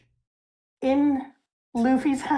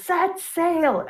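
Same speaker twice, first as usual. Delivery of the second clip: higher-pitched mock call; Luffy's call; 7 semitones higher than usual. Retelling Luffy's command to the crew, lightly called out. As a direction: call it out without extra volume. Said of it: "Luffy calls".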